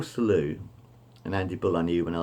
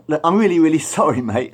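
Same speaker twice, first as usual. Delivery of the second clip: high, loud panicked sorry; frantic apology; 7 semitones higher, 8 dB louder than usual. Retold or imitated. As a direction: beg forgiveness fast and loud. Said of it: "he really apologised".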